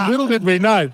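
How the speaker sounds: ordinary talk, nothing marked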